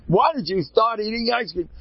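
high-pitched incredulous demand; exaggerated high accusing question; mock scolding. Mock scolding quoted to someone.

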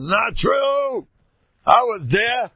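high, loud outburst; emphatic, high-pitched retort; exclaimed protest. Retold or imitated. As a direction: burst out with a loud denial. Acting out Galinsky's amused protest.

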